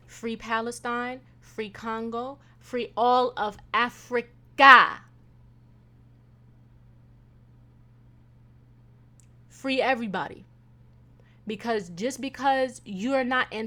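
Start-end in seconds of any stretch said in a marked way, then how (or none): 0.1-10.4 slow, louder proclamation; proclaiming. Real, not acted. Closing the podcast with a call, proclaiming. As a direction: declare it slowly.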